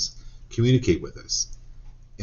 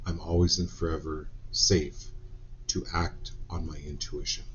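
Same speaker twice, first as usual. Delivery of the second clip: softer, hushed repeat of the affirmation; quiet closing affirmation; 7 dB quieter than usual. Acted. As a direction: let it settle quietly for them.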